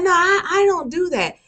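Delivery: imitating a tearful, high-pitched protest; mock the former student whimpering her innocence; whiny denial. Teacher imitates former student denying it, mock tearful. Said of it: "She's like".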